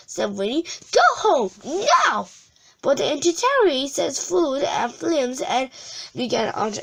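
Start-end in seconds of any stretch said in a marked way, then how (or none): 0.9-2.3 angry order. A narrator's reading. Winnie orders guests out.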